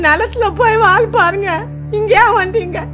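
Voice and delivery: tearful keening over a lost son; wailing lament. Acted. Mother wails over her dead son, tearful lament. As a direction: mourn aloud, voice breaking now and then.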